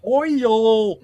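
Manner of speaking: "Oil" said high and stretched into two syllables, "oy-yul". Shown as a demonstration. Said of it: "Oil is two syllable".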